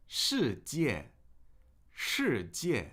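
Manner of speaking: "世界" with both syllables falling sharply, slow; two falling tones. Shown as a demonstration. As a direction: drop hard on each syllable of "世界". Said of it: "two fourth tones".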